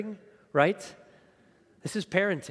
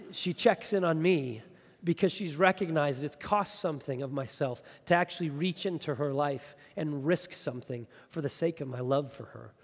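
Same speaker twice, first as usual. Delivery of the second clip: normal talking voice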